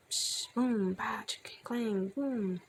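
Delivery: hissed psh, ringing booms and clangs; imitated clanking noises. A narrator's reading. Noises from around the next corner, imitated clanging. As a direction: voice a spooky percussion racket.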